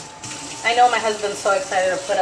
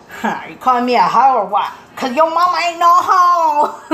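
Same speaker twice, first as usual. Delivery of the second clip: loud sassy comeback, climbing higher; mock offended; 5 semitones higher, 7 dB louder than usual. Mom joking at son's ho ho ho, mock offended. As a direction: act insulted, building to a big finish.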